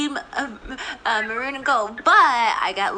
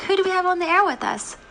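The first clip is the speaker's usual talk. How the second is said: normal talking voice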